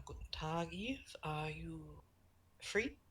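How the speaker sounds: hushed written greeting to Eve; whispered note. Acted. Johann's note to Eve, whispered. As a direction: breathe it out as a secret note.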